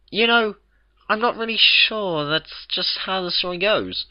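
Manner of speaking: lightly voiced Mrs Cake asking patience; mildly miffed baker. A narrator's reading. Mrs Cake asks Twilight to let her finish, miffed.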